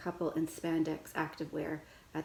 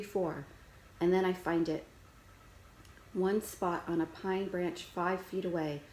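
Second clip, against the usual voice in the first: ordinary delivery, nothing marked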